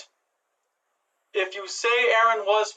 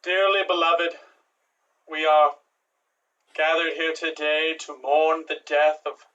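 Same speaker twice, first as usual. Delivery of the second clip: mock solemn eulogy; 4 semitones lower than usual. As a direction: speak like a priest at a funeral. Improv funeral eulogy.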